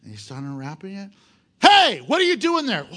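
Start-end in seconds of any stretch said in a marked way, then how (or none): 1.6-2.9 high, loud startled yell; owner's shout. Retold or imitated. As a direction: catch the thieves in the act. Owner catches donkey thieves.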